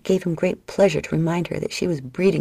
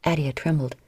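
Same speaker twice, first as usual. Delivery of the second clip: ordinary delivery, nothing marked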